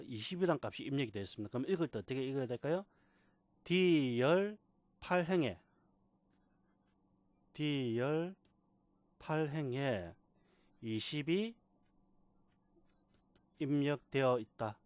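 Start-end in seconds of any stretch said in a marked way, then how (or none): 3.6-14.8 slow read-out in separated chunks; demonstrated reading. Shown as a demonstration. Teacher models reading column D row eight, slow demonstration. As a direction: spell out each part deliberately, with pauses. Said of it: "이렇게 읽어주는 겁니다".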